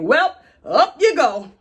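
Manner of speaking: loud, drawn-out mock send-off; breezy hangman. Captors to the man they hang, breezy hangman call. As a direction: imitate an executioner calling it out, slow and high.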